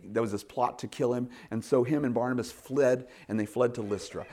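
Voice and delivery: ordinary delivery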